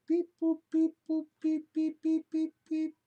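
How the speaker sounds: high robotic computer beeps; old speech synthesizer. Pretend. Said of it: "fire up the fucking supercomputer".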